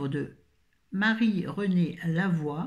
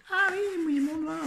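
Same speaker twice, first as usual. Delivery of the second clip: high yelp of surprise at her own finger; a sharp squeal of sudden pain; 13 semitones higher than usual. Real, not acted. She catches her finger on the book, high yelp.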